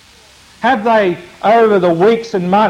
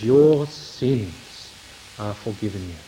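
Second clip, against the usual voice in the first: low, soft, slow and tender; 7 semitones lower, 4 dB quieter than usual. Acted. Christ to the paralysed man, low soft tender forgiveness. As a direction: speak gently, like forgiving a friend. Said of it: "Christ looks into his heart".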